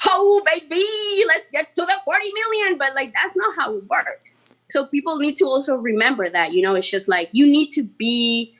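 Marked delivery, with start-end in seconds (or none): 0.1-2.8 mock greedy excitement. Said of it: "I would be like".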